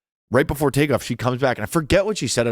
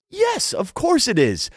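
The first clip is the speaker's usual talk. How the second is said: high, slow sugary imitation; pretending to be the attendant, high and slow; mock flight attendant; 14 semitones higher, slower than usual. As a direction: stretch it out sweetly, much higher.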